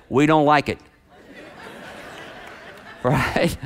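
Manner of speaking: normal talking voice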